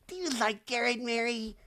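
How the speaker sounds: high, hushed nosy question; prying busybody. Retold or imitated. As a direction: repeat it high but held back. Same question repeated softer.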